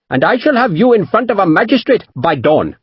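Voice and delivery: high, grand merchant's retort. A narrator's reading. Merchant answering the captain's threat, grandly. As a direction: throw it back with dignity. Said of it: "Panjit answered just as grandly".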